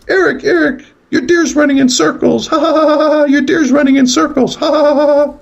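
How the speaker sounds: put-on very high ghostly girl voice, laughing; little girl's voice. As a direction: taunt in a high singsong little-girl squeal. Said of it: "I'm hearing a girl's voice".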